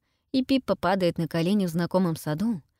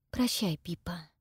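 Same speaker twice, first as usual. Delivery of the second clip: tearful farewell; 6 dB quieter than usual. A narrator's reading.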